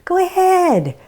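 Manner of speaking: low, quick murmur lifting to an urging go-ahead; tempting inner voice. Acted. Voice of food craving talking you into another one, coaxing. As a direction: lightly act out the nagging craving voice. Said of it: "louder voice where it says".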